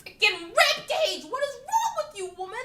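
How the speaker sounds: very high, slow mock outrage; high mock indignant shout. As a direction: shriek in mock exasperation. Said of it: "I'm like".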